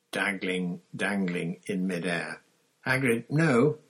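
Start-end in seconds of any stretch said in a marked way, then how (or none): 2.9-3.8 loud, raised urgent command; shout. A narrator's reading. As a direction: shout to stop him at once. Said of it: "Dumbledore shouted".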